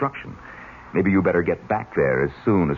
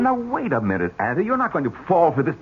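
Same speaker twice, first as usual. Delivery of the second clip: higher, incredulous protest; exasperated objection; 10 semitones higher than usual. Acted. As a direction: stop the aunt from being fooled. David objects to aunt.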